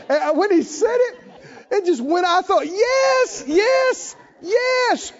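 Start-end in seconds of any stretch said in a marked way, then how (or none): 2.7-5.1 high excited cheer; excited yes. Retold or imitated. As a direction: burst out with delight. Joyful exclamation in story.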